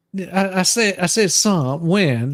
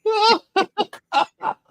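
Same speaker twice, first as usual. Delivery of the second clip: high giggling through the words; laughing; 12 semitones higher than usual. Real, not acted. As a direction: laugh while saying it.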